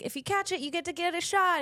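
high-pitched call to passers-by. Retold or imitated. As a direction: shout an invite out of the window, retold. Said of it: "we're yelling out the window".

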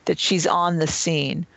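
ordinary talk

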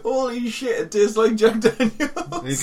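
high, loud laughing surprise; surprised laugh. Real, not acted. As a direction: burst out laughing in disbelief. Suddenly tastes the Jack Daniel's.

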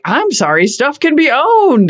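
high, slow, prissy mock boomer. Co-host mocking boomers' view on ownership, high and slow. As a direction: drag it out like a stuffy boomer. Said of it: "boomers are like".

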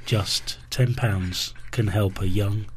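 slow, soft charity ad read; charity appeal voice. Acted. Charity ad parody.

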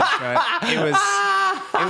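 high-pitched laughing confirmation. Real, not acted. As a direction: talk right through real laughter. Cracking up over the bit.

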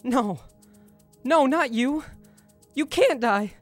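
high, cracking disbelief; distraught denial. Acted. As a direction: refuse to believe it. Mentor says he is dying.

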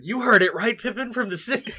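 high, loud appeal to a drinking buddy; hammed-up tipsy hobbit storyteller; drunk, excited Merry. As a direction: call on your friend to back you up, laughing. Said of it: "Merry's just like".

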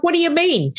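high-pitched mock confused question; imitated puzzlement. Guests asking the singer to explain, imitated puzzlement. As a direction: lightly mimic a baffled listener.

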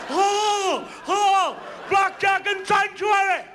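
high, loud, slow hammed-up wail; mock panic. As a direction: ham up a terrified gambler at the table.

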